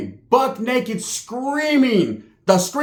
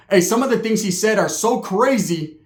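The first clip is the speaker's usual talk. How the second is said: normal talking voice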